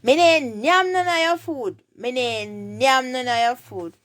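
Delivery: emphatic high Jamaican Patois reply. Shown as a demonstration. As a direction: deny it in Patois, pitch jumping up.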